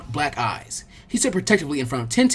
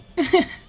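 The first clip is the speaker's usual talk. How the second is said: little laugh; 13 semitones higher than usual. Acted. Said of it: "she laughed a little".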